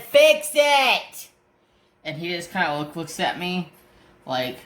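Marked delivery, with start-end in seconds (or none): 0.0-1.3 yelled high and loud; mock shout. To the post office clerk, a retold shouted demand. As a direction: bark the order at the clerk.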